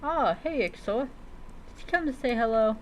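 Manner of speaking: ordinary talk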